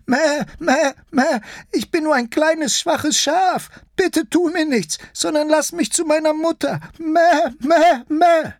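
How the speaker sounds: very high, bleating little sheep voice; high-pitched timid lamb, begging; frightened sheep-lion. Acted. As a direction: plead in a tiny high voice, bleating loudly.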